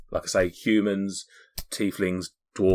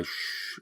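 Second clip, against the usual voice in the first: unvoiced shush; soft, hissed "shh" for silence; hushing; 14 dB quieter than usual. GM acts out a character signalling silence, hushing. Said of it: "to sort of say".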